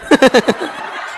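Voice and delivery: soft unvoiced chuckle; laugh. Real, not acted. Speaker amused by own ambulance tale, breathy chuckle. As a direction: chuckle under the breath at your own joke.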